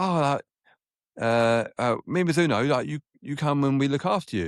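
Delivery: high, eager imitation of the rep; imitating the Mizuno man. Mizuno rep offering to look after the young golfer, eager and high.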